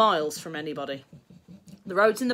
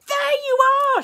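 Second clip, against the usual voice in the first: high, loud furious yell; shouting madly; 11 dB louder than usual. A narrator's reading. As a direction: explode at mum in frustration. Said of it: "shouted William madly".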